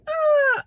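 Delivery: high loud mock beep of the indicator; indicator beep imitation. Copying the car's indicator for viewers, loud high beep. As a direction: squawk it like the car's annoying indicator. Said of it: "the indicator that goes like this".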